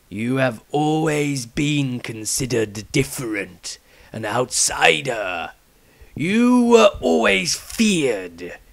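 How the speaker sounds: very slow, grave elder's voice; the patriarch challenging Maestro, slow and grave; stern old patriarch. Acted. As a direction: weigh every word like a judge. Patriarch speaks.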